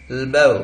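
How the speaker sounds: "लबो" starting on a bare l, no e; connected-speech form. Shown as a demonstration. Teacher showing connected speech, syllabic l. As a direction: drop the first vowel of elbow, "लबो". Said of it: "connected speech में बोलेंगे".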